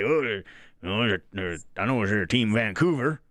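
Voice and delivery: lightly put-on Texas accent, a bit low; terrible mock Texas accent. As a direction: imitate a Texan, badly. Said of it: "That's my Texas accent".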